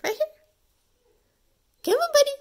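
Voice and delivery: high, loud call to a dog; dog talk. Real, not acted. Coaxing a dog.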